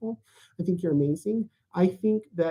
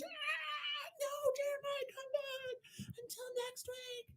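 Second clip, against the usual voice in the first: high falsetto goodbye; 21 dB quieter than usual. Acted. High sign-off voice.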